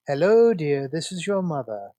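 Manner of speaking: grand, stretched-out telephone greeting; mocking mother impression. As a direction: put on an old Hollywood matron's diction. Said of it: "Carrie used to do a mocking".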